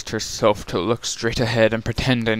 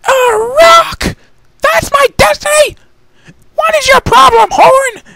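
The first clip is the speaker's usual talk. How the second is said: very high, much louder ranting lady; indignant outburst; 9 dB louder than usual. Acted. Rarity yelling at her horn, high and furious. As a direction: rage at her own horn.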